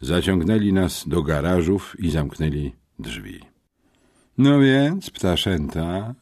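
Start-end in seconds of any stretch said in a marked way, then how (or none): 4.4-6.2 drawn-out taunt, high and loud at the start; drawled menace. A narrator's reading. Captor addresses the two boys in the garage, drawling. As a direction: stretch the words out at your captives. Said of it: "wycedził Alfred".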